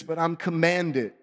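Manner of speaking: usual speaking voice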